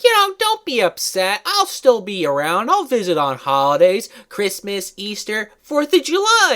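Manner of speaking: high, lilting put-on grandma voice; mock-reassuring grandma. Grandma promises the kid holiday visits, high mock-reassuring voice.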